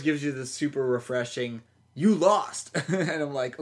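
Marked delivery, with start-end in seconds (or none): 1.9-2.8 cheery notification voice. Retold or imitated. Quoting cheery eBay email.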